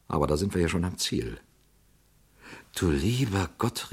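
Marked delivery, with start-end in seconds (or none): none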